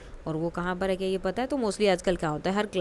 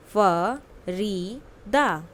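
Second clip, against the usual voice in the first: "Farida" drawn out syllable by syllable; very slow model word; 3 dB louder, much slower than usual. Shown as a demonstration. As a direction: spell the name out slowly by sound. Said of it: "listen to your teacher and say the following word". (बहुत धीरे, अक्षर-अक्षर "फरीदा")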